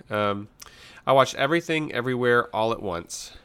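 normal talking voice

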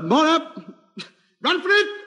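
high urgent call to flee. Acted. Host calling his mates to run, high urgent call. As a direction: yell to the gang to scarper. Fleeing the police.

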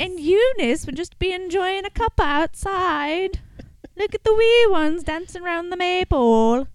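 high put-on northern English accent; a quaint northern English village voice. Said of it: "like, a weird northern English accent".

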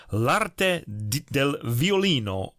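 "L'arte" with a trilled Italian R; rolled r. Real, not acted. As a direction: roll the R in "L'arte di, del violino". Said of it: "here comes another rolled R".

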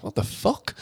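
soft baffled what the fuck; under your breath, baffled; muttered disbelief. Pretend. Fan stunned by chocolate talk, softly baffled. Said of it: "And you'll just be like".

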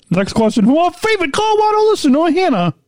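high, bright announcer sing-song; radio voice. Pretend. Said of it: "my radio voice?".

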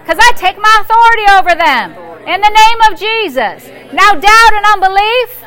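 loud, rising preacher's declaration; preaching at full force. Acted. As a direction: declare it over the congregation with fire.

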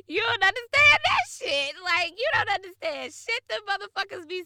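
loud, high-pitched exclaiming; worked-up comic complaint; animated rant. Real, not acted. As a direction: burst out loud and high, incredulous. Laughing rant about texts and accents.

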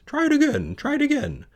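very high goose squawk; excited high bird chatter; goose crying out. Acted. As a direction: urge on in a squeaky goose voice. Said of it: "cried the goose".

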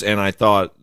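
ordinary delivery

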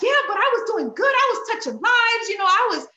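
high, loud whiny protest. Retold or imitated. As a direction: whine that she was doing good. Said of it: "And I'm like".

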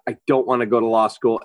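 ordinary talk

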